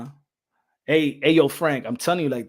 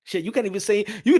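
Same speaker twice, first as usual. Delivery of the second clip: usual speaking voice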